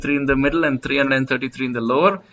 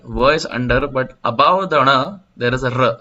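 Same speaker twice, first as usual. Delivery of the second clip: normal talking voice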